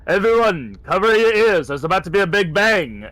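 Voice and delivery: high, loud shout to everyone; yelled warning. Acted. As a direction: yell it out as an in-character warning. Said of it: "I'm just gonna yell".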